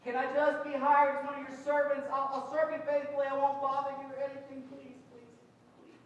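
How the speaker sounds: loud, high begging confession; begging his father for a servant's job; pleading son. Retold or imitated. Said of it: "It's like".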